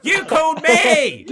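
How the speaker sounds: high, loud, slow yell with a laugh; furious yell. Retold or imitated. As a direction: bellow it out, laughing at the absurdity. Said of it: "so fucking pissed off".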